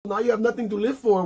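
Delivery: high, taunting villain; hammed-up high gloating; mock villain taunt. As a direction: twist the knife with a high taunt. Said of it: "he's like".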